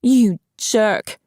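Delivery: indignant outburst, two punched words; outraged cry. A narrator's reading. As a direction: hurl the insult in outrage. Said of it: "she cried".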